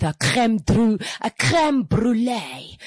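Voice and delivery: slow, hammed-up French dessert name; French accent. Diner ordering a starter, exaggerated French accent. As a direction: overdo the French accent, stretching each syllable. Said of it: "so how the accent changes".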